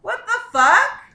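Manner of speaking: high, loud comic outburst; mock outrage. Outraged at being called loud, ironically shouting. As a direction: shout it, pitch shooting up.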